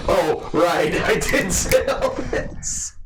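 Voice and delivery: high, loud laughing imitation; mock sudden realization. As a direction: imitate a dawning recollection, cracking up into laughter. Laughingly acts out player's sudden recollection.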